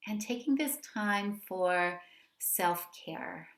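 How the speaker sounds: unhurried meditation guidance with long pauses; guided meditation. Real, not acted. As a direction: gently lead them into settling, phrase by phrase.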